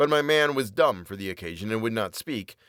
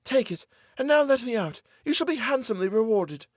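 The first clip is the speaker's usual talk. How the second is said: much higher, desperate woman begging; pleading captive woman; 13 semitones higher than usual. A narrator's reading. Captive woman pleading with her jailer for mercy. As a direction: beg for mercy in a high woman's voice.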